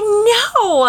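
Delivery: high, loud put-on refusal; a hammed-up flat no; mock defiant refusal. [name] refusing Maine, mock defiant no.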